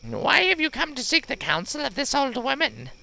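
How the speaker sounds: high, put-on old crone voice; old wise woman voice. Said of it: "She's got one of these voices".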